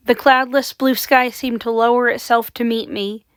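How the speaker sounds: ordinary delivery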